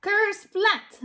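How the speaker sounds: usual speaking voice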